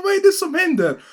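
very high shocked exclamation; panicked cry. Retold or imitated. Teacher seeing the burned scalp, high panicked shriek. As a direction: shriek it in horror.

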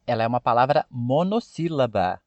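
normal talking voice

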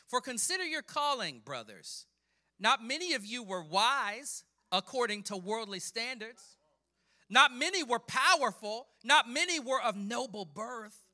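loud, high, building proclamation; forceful scripture preaching. Acted. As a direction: drive each phrase harder toward a peak. Preacher declaims Paul's letter.

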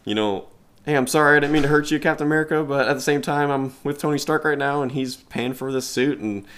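put-on high, bright teen-hero voice; quippy Spider-Man. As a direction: play it up as the chatty kid hero. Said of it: "making those quips like".

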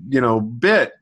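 usual speaking voice

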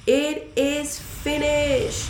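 long, high, drawn-out cry; slow triumphant shout; loud shout. A narrator's reading. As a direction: proclaim it with full voice, every word stretched. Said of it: "Jesus shouted in a loud voice".

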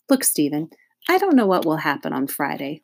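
plain reading voice

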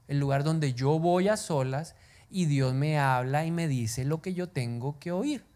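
ordinary talk, nothing marked

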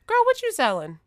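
put-on high, loud chatty girl voice; pry with a big bright voice; nosy neighbour voice. Truck driver nosily quizzing her about the ice cream, high. Woman asks what she's selling.

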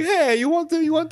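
high, loud mock Italian waiter; Italian accent. Playing an Italian waiter offering dishes, mock Italian accent. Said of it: "that was an Italian accent".

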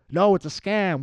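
loud, high mock insistence; exaggerated outraged objection; stubborn protest. Acting stubborn objector to mail-in voting.

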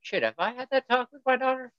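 sudden high, strained self-talk in a far-off voice; a dad hit by alarm, voice leaping way up; panicked inner thought. Retold or imitated. Dad realizing he never had the talk, high alarmed voice. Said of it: "And I was like".

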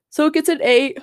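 words stretched by a yawn. Real, not acted. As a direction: say it mid-yawn. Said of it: "I'm yawning".

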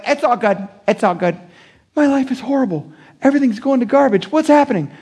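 high, strained pretend cheer cracking; mock fake fine. Someone walking out of church pretending, high and cracking. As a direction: say it's fine while it falls apart.

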